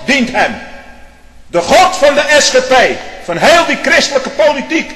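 high, loud, thundering pulpit cry; fiery preacher shouting down judgment; preaching at full force. Acted. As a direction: rail at them from the pulpit. Fiery preaching against politician.

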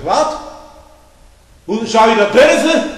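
loud, high indignant retort; outraged neighbour. Retold or imitated. Hospital neighbour reacting to the threat, loud indignant outburst. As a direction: mimic a shocked man snapping back.

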